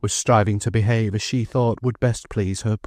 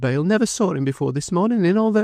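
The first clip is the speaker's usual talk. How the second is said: high, choked-up pleading; choking voice; 10 semitones higher than usual. A narrator's reading. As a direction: plead through tears, rising at the end. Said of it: "with her choking voice".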